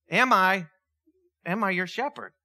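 usual speaking voice

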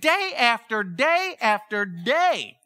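each "day" drawn out slowly; slow, weighty stress on every "day"; stretched repetition. Real, not acted. Preacher stressing the wife's relentless asking, stretched-out "day after day".

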